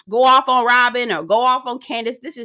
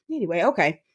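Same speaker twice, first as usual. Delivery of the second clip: ordinary talk, nothing marked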